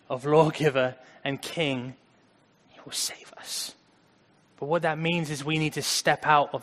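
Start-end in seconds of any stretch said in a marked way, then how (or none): 2.7-3.7 hushed, unvoiced whisper; breathy hush of reassurance. Real, not acted. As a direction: drop to a whisper for the promise. Sermon, hushed climax.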